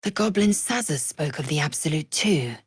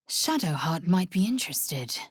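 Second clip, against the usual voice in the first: normal talking voice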